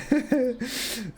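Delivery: squeaky laugh; high wheezing laugh. Real, not acted. Laughing at robot voice.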